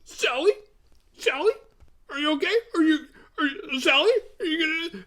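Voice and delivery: very high, whiny mocking falsetto; Franklin. Calling after Sally from a wheelchair, mocking high impression. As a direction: pester in a squeaky, questioning voice. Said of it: "all I can hear in my head is Franklin's voice going".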